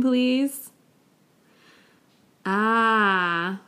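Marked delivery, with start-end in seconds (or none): 2.4-3.6 an easy ah of acknowledgement; a casual ah, okay; ah for I see. Shown as a demonstration. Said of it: "is okay, I see".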